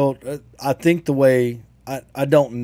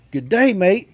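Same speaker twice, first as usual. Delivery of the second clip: high, loud mock Aussie greeting; kids' Australian accent; 8 semitones higher, 5 dB louder, slower than usual. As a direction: kids calling out to the PE teacher in an Australian accent. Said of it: "to him in his native accent".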